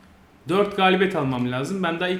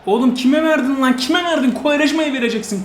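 high, loud angry shout at the player; furious yelling; 10 semitones higher, 9 dB louder than usual. Real, not acted. Gamer raging at his player for a wrong pass, furious shouting. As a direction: scold the footballer at full voice.

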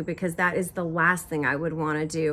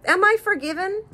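excited; 13 semitones higher than usual. Real, not acted. Still laughing, then asking forgiveness.

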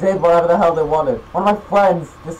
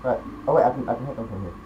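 normal talking voice

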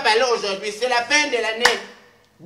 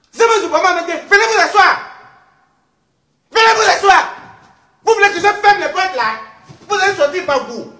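loud, high comic scolding; bossy ordering; 7 semitones higher, 9 dB louder than usual. Acted. Host orders her unruly chroniclers to sit down, bossy scolding. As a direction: order them about at full voice, pitch raised.

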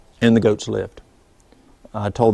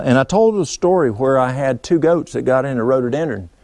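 ordinary talk, nothing marked

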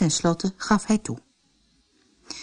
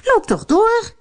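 impatient call; 4 dB louder than usual. Acted. Stepmother calls to Hans.

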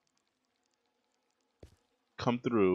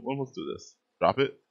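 normal talking voice

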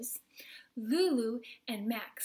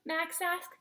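ordinary delivery, nothing marked

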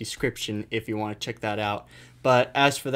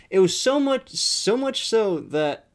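excited; 8 semitones higher than usual. Real, not acted. Building up the outrageous story.

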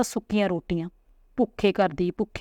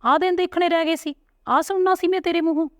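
much higher, bitter angry outcry; angry retort; 10 semitones higher, 3 dB louder than usual. Acted. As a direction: throw it back at him, wounded and furious. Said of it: "ਦਰਸ਼ਨ ਵੀ ਗੁੱਸੇ 'ਚ ਆ ਗਿਆ ਤੇ ਬੋਲਿਆ". (ਉੱਚੀ ਸੁਰ ਵਿੱਚ ਗੁੱਸੇ ਭਰਿਆ ਜਵਾਬ)

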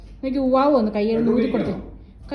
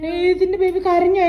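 ordinary delivery

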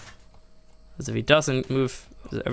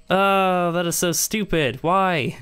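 annoyed; 6 semitones higher, much slower than usual. Real, not acted. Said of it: "I'm annoyed".

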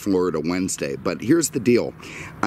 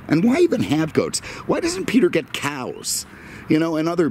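exasperated disbelief; 10 semitones higher than usual. Real, not acted. Ranting about goat droppings.

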